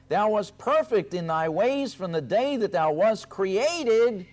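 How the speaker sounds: high, loud, declaiming the prophecy; forceful scripture reading. Acted. Preacher reading Ezekiel to his congregation, loud and high.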